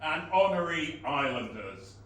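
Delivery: loud booming proclamation; town crier. Acted. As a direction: town crier calling the crowd.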